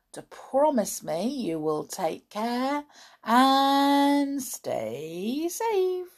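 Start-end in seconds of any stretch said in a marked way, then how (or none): 1.9-6.1 tender. Real, not acted. Warm sign-off to listeners.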